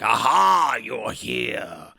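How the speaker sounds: Peter O'Toole voice. Pretend. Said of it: "that's my Peter O'Toole voice".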